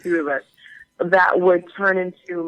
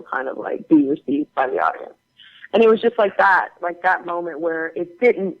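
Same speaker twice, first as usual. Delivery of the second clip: ordinary delivery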